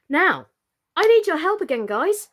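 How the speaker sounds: high, loud and eager with a laugh; rally the viewers, bubbling over; excited appeal. Real, not acted. Host eagerly asks the kids for another vote.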